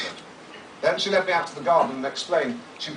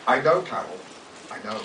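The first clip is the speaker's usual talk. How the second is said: mild tender reassurance; 6 semitones lower than usual. Retold or imitated. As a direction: soothe her gently, fading away. Consoling his wife.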